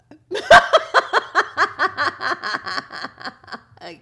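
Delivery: loud, high cackle in a long rapid run; real laughing fit. Real, not acted. Streamer cracks up at Kayla's comment, loud long cackle. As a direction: burst out laughing mid-sentence.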